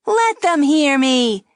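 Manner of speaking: high, loud, slow puppet taunt; defiant loud dummy. A narrator's reading. Dummy taunting the girl aloud. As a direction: play to the room. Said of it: "the dummy said".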